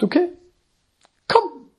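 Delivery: high, breezy "okay, come"; casual Jesus. Retold or imitated. Jesus casually calls Peter onto the water, breezy and high. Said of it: "Und Jesus sagt".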